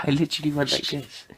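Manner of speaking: ordinary talk, nothing marked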